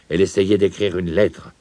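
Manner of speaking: ordinary delivery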